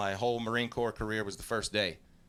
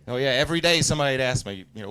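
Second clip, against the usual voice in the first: ordinary talk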